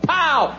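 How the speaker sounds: high, exclaimed bang noise; imitated gunshot. Bartender shoots the dog in the foot, imitated gunshot. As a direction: pop it out like a pistol shot.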